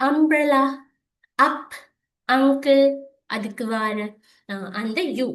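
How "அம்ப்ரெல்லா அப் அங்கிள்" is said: loud, high, separated "அம்ப்ரெல்லா, அப், அங்கிள்"; example words. Shown as a demonstration. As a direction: punch the opening short "அ" in each word. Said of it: "யூ லெட்டர்ல ரெண்டு உச்சரிப்பு".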